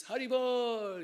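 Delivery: slow, loud, high sung-out Hari Bol; drawn-out call. Retold or imitated. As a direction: call it out long and joyfully. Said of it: "with the hands like this".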